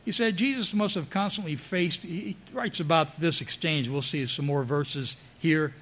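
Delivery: usual speaking voice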